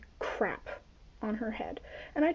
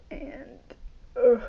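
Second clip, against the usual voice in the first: ordinary talk, nothing marked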